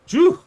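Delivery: loud, high exhale of relief; relieved phew. Real, not acted. Relieved after being startled, loud high phew. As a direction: blow out the fright.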